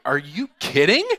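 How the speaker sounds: high, loud "are you kidding"; stunned that she means it; disbelieving. Retold or imitated. Disbelief at her demand.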